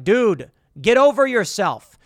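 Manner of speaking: normal talking voice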